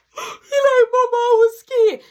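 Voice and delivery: high, loud sobbing plea; a scared boy bawling to his mother; crying kid. Retold or imitated. Mark crying to mama.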